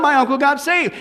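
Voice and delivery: ordinary delivery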